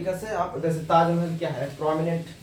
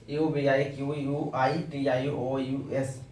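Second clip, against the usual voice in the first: normal talking voice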